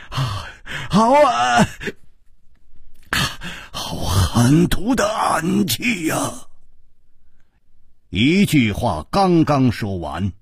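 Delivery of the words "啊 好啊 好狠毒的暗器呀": strained, drawn-out wail of pain; hoarse agonized cry. A narrator's reading. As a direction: cry out as if badly wounded. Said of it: "他嘶声惨呼道". (嘶声惨呼)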